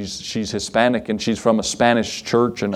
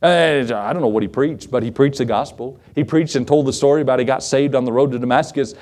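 ordinary talk

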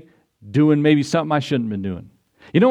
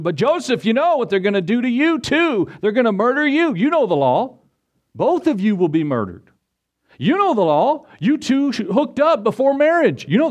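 high-pitched, insistent acted warning; urgent warning; 6 semitones higher than usual. Townsfolk warning Joseph of the law, high and urgent. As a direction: press the danger on him, pitch climbing high.

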